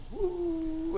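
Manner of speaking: high, soft "whoo" glide; soft plane whine. Retold or imitated. Imitating the plane coming in, soft high whoosh. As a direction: whistle down like a descending plane.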